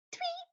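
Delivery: tiny, very high, soft chirp; squeaky birdsong imitation; bird tweet.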